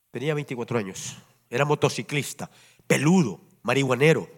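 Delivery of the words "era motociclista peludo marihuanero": usual speaking voice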